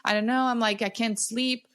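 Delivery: lightly voiced desperate plea for help; pleading sister-in-law. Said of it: "she goes".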